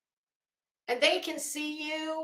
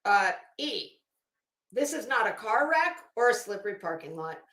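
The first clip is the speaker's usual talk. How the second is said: usual speaking voice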